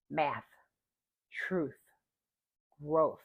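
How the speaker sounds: math, truth, growth ending in an airy th; exaggerated th practice. Shown as a demonstration. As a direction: hold the final th. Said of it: "in the final position".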